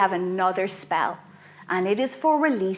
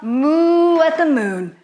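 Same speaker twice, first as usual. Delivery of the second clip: higher, drawn-out mooing voice; mooing calf; 5 semitones higher, 4 dB louder, slower than usual. Shown as a demonstration. Teacher showing kids how a moon calf moos. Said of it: "be a moon calf".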